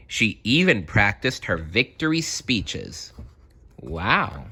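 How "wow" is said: plain reading voice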